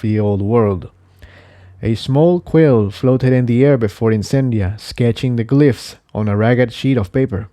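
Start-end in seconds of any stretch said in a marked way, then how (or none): none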